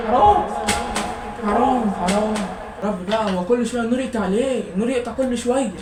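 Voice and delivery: real exasperated outcry, half shouted; loud exasperated complaining. Real, not acted. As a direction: rant at the power cut as it ruins studying. Power cut ruins study time.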